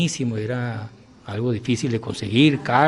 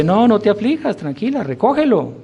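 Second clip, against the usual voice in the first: reassuring; 8 semitones higher than usual. Retold or imitated. Saint calms the weeping nurse over the broken sieve, reassuring. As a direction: soothe her gently but with lift.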